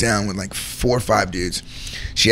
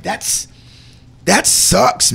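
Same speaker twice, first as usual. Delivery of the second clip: pitched up high in dismay; exclaimed; 10 semitones higher, much slower than usual. Real, not acted. Telling his host about the lonely cashier, high dismay. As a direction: cry out how unfair it is.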